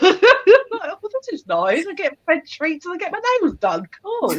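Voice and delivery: smug pampered cat. Retold or imitated. Owner voicing Zach enjoying treats, high pleased voice. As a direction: purr out the pleasure of being spoiled.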